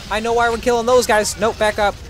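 ordinary talk, nothing marked

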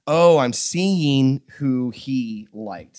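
slow, emphatic "now I get it"; dawning realization. Acted. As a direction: stretch every word as it dawns on you. Acting out his slow realization.

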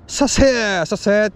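high, clipped mock greeting of a fired-up clerk; keen shop clerk. Imitating the convenience store clerk greeting customers: mock keen call. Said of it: "いきなり気合入っとるんで".

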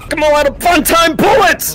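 high, unhinged yell; manic shout. Acted. Seeker out of bullets, manic shout. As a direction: yell it like a crazed gunman.

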